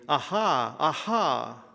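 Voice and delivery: ordinary talk, nothing marked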